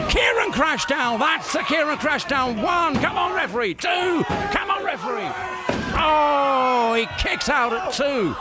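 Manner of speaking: excited, high shouting play-by-play; wrestling commentator. Real, not acted. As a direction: yell the near-fall count. Commentator calls finisher and count.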